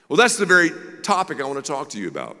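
ordinary talk

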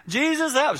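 high, loud cry for help; calling out in prayer. Retold or imitated. Praying mom crying out to Jesus, desperate call. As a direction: pitch leaps up, calling on Jesus. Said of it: "She would just call out".

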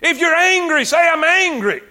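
high, driving preacher's delivery; urgent exhortation to the congregation; impassioned preaching. Real, not acted. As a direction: preach it high, drawing out the key words. Preaches at full force to congregation.

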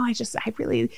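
hushed, breathless whisper of wonder; soft secret awe; awed whisper. Retold or imitated. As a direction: whisper it like you just fell for her. Whispering awe over the woman's face.

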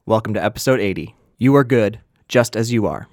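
ordinary talk

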